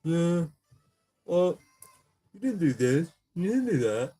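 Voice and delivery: mock them in a snotty voice; hammed-up know-it-all kid; mocking whiny kid. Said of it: "people are just like".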